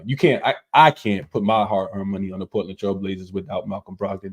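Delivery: usual speaking voice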